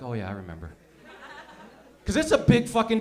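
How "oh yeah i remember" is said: low, soft, dreamy recollection; far-off reminiscing. Acted. Acting out guys recalling their first time, low and far-off. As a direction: drop way down, lost in a memory. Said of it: "got that far off look".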